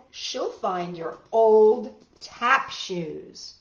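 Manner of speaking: usual speaking voice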